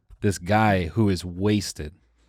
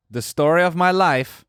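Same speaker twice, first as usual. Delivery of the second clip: higher, louder Borat-like parting line; depressing Borat accent; 8 semitones higher, 5 dB louder than usual. Retold or imitated. Drunk guy turns back to us, Borat-accented. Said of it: "depressing Borat".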